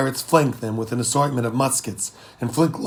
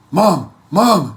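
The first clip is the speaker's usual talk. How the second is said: loud, higher call across the deck; 5 semitones higher, 8 dB louder than usual. Acted. Boy runs up calling his mom, loud calls. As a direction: shout for her from a distance.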